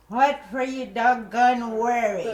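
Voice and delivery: lightly voiced old granddad, loud and amused; a touch of the old man teasing the baby; grandfather's broken English. Grandfather teasing the worried-looking newborn, loud and amused. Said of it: "he started naming him".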